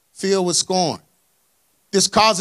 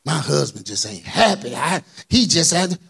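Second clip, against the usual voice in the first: mock sulky complaining wife; a light imitation of a grumbling wife; whiny wife; 5 semitones lower than usual. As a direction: sulk it out like a tired wife. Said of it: "listen to even the way you saying it".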